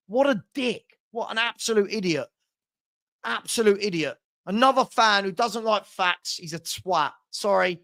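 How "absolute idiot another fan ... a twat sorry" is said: ordinary talk, nothing marked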